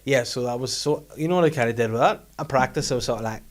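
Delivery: normal talking voice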